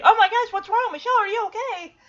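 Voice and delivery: high, hurried worried cry; mock alarm. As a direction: rush in like a startled parent. Imagined family rushing in alarmed.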